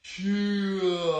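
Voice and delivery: long, loud drawn-out karate cry; slow-motion kiai. Shown as a demonstration. Coach punching in slow motion with kids, slow-motion kiai. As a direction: stretch the kiai out, projecting it. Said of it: "I like that slow motion key out".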